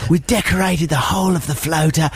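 high, hammed-up gushing Aussie mate; badly faked Australian, raving about it; mock Australian accent. Australian friend raves about surfy floater dinner, mock Australian accent. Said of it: "they're very poor Australian accents".

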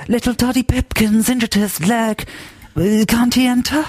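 high-pitched sob-story plea; mock-pitiful pleading. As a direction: plead a pathetic case, hammed up. Pleading for an invented child.

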